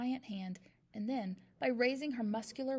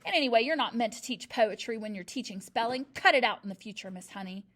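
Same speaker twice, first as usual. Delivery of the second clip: scolding; 8 dB louder than usual. A narrator's reading. Headmistress scolds teacher.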